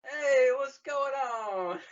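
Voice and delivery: high, slow, loud dopey greeting; copying the goofy rebel voice; imitating friend's voice. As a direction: mimic the Resistance voice. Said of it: "This is character you're doing".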